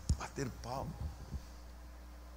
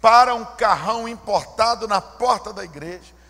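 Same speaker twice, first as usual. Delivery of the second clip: ordinary delivery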